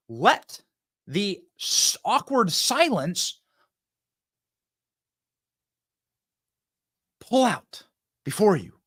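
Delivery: slow, stretched words; drawn out slowly. Shown as a demonstration. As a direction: let the words stretch out like the silence.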